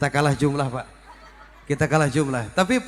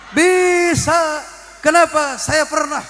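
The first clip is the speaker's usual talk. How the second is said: excited answer; 8 semitones higher, 3 dB louder, slower than usual. Acted. Answers own question to audience.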